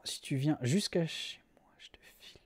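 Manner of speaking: hushed, secretive whisper; mock secret offer, whispered; conspiratorial whisper. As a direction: whisper it like a shady deal. Joking secret offer to a viewer.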